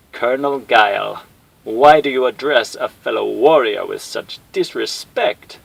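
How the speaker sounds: slow, loud menacing villain; aggrieved warlord. Acted. Bison chiding Guile over the broadcast, slow villain voice.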